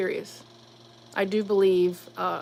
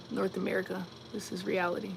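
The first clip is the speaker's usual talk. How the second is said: ordinary delivery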